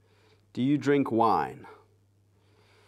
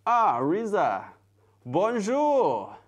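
loud, high, drawn-out welcome; exuberant French greeting; 9 semitones higher, 6 dB louder, much slower than usual. Real, not acted. Host greeting Riza in French, exuberant high call. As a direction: sing out the greeting with delight.

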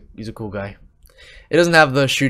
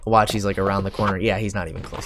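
ordinary talk, nothing marked

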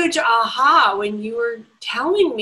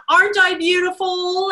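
sassy horse; 7 semitones higher, 3 dB louder than usual. Retold or imitated.

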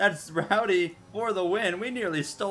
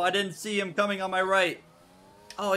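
ordinary delivery

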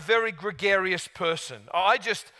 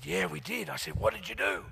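put-on lightly done Steve Irwin Australian accent; talking like Steve Irwin; 3 semitones lower, 6 dB quieter than usual. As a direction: slip into a Steve Irwin impression. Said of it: "We're both talking like Steve".

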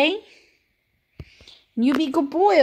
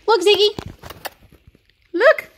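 high, loud call to the dog; sing-song call for attention; 6 semitones higher, 4 dB louder than usual. Real, not acted. Calling Ziggy to look at the camera, high and loud.

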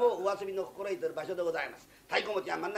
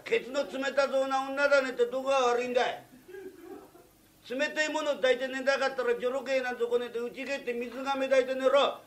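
loud, higher and angry throughout; drunken rant; 7 semitones higher, 7 dB louder than usual. Acted. Drunk craftsman snaps back at his boss, loud and angry. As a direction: bark it back, fully riled. Drunk guest rants at master.

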